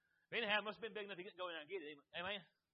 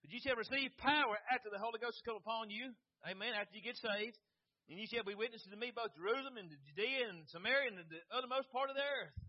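scripture reading; 4 dB louder than usual. Acted. Preacher reading scripture to congregation, proclaiming. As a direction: read the verse out with rising force.

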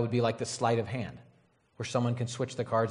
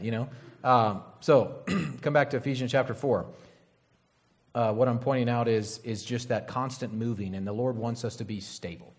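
normal talking voice

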